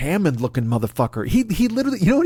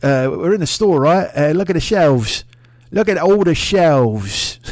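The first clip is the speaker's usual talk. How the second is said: mock English accent; 3 semitones higher, 4 dB louder, slower than usual. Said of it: "everybody's like".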